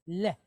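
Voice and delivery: Le said as a short l plus open e; Hebrew syllable demo. Shown as a demonstration. Teacher sounds out the syllable for the class, careful Hebrew pronunciation. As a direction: model the syllable Le slowly for learners. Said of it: "with a Hey behind it".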